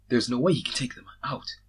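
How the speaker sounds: usual speaking voice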